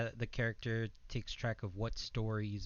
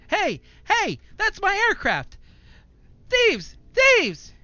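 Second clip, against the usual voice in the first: shouting high and loud at thieves; angry shouting; 15 dB louder, slower than usual. Acted. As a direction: yell it at the top of your lungs. Aircraft being stolen, shouting.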